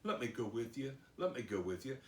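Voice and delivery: acted meek request; soft begging. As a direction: beg gently, as the healed man. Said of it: "begged Jesus".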